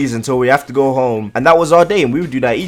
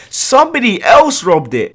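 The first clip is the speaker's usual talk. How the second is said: scornful echo, pitched higher; mocking repeat; 8 semitones higher than usual. Mocking the bike thief's lame excuse, pitched higher. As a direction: parrot his excuse back in disbelief.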